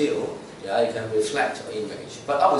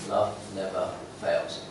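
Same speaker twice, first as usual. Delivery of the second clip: "Love never fails" repeated lower and softer; soft echo; 5 semitones lower, 7 dB quieter than usual. Shown as a demonstration. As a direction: let "Love never fails" settle quietly. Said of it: "repeated key verse".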